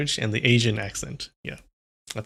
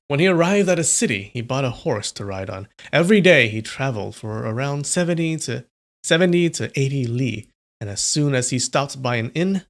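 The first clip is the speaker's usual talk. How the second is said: louder, steady read-aloud narration; story narration; 4 dB louder than usual. A narrator's reading. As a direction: read the chapter out to chat like a storyteller. Reads novel chapter aloud.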